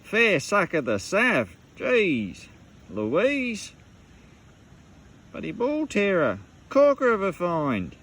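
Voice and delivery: excited Aussie bloke hamming it up; hammed-up Aussie slang. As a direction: ham up the Aussie slang like a larrikin. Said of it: "You don't get much more bloody Australian than that".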